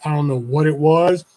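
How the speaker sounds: ordinary talk, nothing marked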